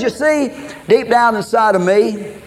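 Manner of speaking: ordinary delivery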